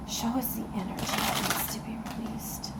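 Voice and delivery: hushed whisper with long pauses; whispered request. Real, not acted. Tarot reader asks the cards for guidance, whispered.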